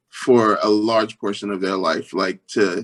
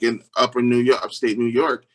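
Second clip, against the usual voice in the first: ordinary talk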